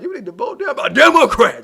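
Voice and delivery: mocking quote. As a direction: parrot her like she's lecturing you. Mocking woman at a conference.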